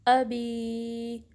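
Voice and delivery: अबी with a crisp hamza on अ, said very slowly; sharp hamza, drawn out. Shown as a demonstration. Teacher recites अबी from Surah Masad for students. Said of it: "आ को sharply पढ़ना है".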